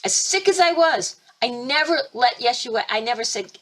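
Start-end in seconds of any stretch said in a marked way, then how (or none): none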